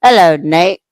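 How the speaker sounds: loud, rising sly hello; smooth greeting. Retold or imitated. Sterling greets Nate, loud and sly. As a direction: greet him like an old rival. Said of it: "then you just hear".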